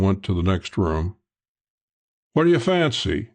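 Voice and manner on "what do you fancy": lightly called out, pitched up; calling through door. A narrator's reading. Host asking guest his drink, calling lightly. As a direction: call it through a doorway, without shouting. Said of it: "he called through the door".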